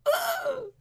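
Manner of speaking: very high cartoon yelp; squealed comic groan; mock pained grunt. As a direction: cry out as if hit. Voices a character being hit.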